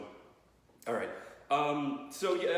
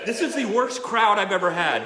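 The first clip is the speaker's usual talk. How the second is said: very loud, higher mock outrage; exasperated complaint; 4 semitones higher, 12 dB louder than usual. Real, not acted. Comic to heckling parents, loud exasperated complaint. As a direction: berate your audience at full volume.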